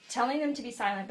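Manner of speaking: ordinary delivery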